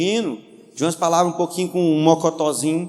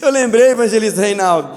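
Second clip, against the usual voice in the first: ordinary talk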